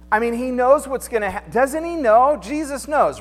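ordinary talk, nothing marked

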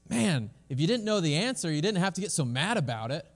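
mock-indignant protest; beaten lion protesting. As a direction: deliver the punchline as the wounded lion. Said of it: "And the lion says".

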